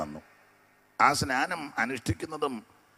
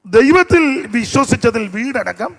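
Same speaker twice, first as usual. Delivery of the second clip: ദൈവത്തിൽ called out high and loud, rising at വീടടക്കം; loud proclamation; 11 semitones higher, 13 dB louder, slower than usual. Shown as a demonstration. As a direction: proclaim the line so all can hear. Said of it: "അപ്പൊ ശ്രദ്ധിക്കുക". (ദൈവത്തിൽ എന്ന വാക്ക് ഉയർന്ന ശബ്ദത്തിൽ ഉച്ചത്തിൽ)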